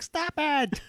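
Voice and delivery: very high, squealed protest; mock exasperation. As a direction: play someone driven crazy by humming. Acted annoyed reaction to the humming.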